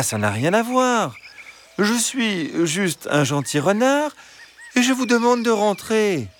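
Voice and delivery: high, smooth fox voice; high persuasive fox. A narrator's reading. Fox arguing with hens about fables, high and smooth. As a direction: reason with them sweetly.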